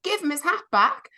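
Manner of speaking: high, outraged demand to give the hat back; indignant plea. Acted. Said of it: "I really feel for that man".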